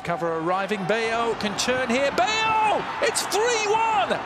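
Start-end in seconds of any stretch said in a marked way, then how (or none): 2.1-4.2 high, stretched score call; excited goal call. Real, not acted. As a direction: call it before the flag. Goal call, then offside.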